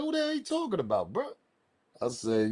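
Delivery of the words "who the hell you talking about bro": high mock callout. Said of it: "I'm like".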